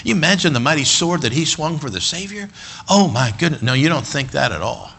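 usual speaking voice

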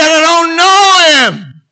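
loud, high, drawn-out shout; angry denial. Acted. Peter swearing he doesn't know Jesus, angry shout. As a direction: yell the denial slowly at full voice. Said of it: "Peter cursed and swore".

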